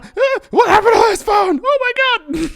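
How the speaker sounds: very high, full-volume screaming Santa; furious Santa shout. Acted. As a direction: lose it at the kid. Angry Santa interrogates.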